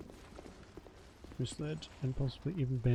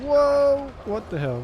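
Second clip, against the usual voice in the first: loud high startled yelp; whoa, yelled much higher and louder; 13 semitones higher, 8 dB louder, slower than usual. Real, not acted. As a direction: jump at a sudden scare. Startled by enemy.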